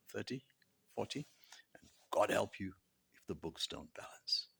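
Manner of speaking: ordinary talk, nothing marked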